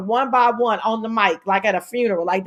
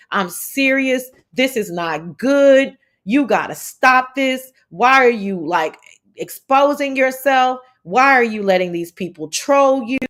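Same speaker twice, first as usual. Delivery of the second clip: ordinary delivery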